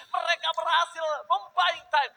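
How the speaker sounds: hyped esports play-by-play shout; fighting game announcer. Acted. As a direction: yell the big steal call. Live esports casting climax.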